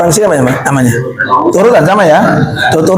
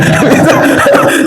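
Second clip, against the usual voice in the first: laugh; 6 semitones higher than usual. Real, not acted. Laughs at his example.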